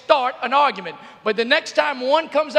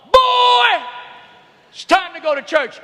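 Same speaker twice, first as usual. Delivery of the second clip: booming call of a father down the hall, the first word much higher and louder; dad calling; 5 semitones higher, 3 dB louder than usual. Retold or imitated. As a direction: call the boy like a stern dad. Dad calling son to church.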